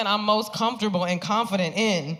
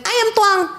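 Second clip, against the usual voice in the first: put-on very high little-kid voice; cute toddler voice; 7 dB louder than usual. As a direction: squeak it out like a proud toddler. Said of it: "that cute little toddler voice".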